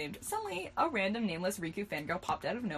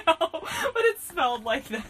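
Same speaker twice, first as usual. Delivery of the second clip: laughing; 9 semitones higher, 6 dB louder than usual. Real, not acted. Laughing through the line.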